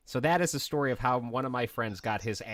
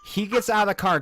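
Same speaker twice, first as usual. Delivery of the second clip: ordinary talk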